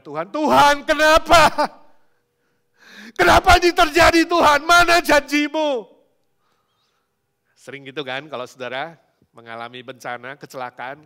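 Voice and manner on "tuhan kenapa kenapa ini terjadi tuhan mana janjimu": full-force wailing protest; anguished shouted prayer. Acted. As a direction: shout accusingly at the top of your voice. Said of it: "langsung teriaknya Tuhan dulu kan".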